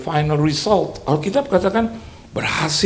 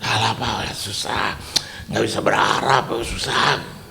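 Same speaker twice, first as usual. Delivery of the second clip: despairing whisper; 5 semitones lower, 3 dB quieter than usual. Retold or imitated. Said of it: "Ya, gitu, panjang ya".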